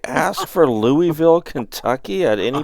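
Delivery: loud, high, incredulous; who asked for this?!; indignant surprise. Real, not acted. Unwanted Louisville result.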